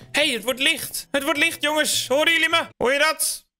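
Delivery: rising eager call out; excited calling. Real, not acted. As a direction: announce the sunrise eagerly. Excited calling to friends at dawn.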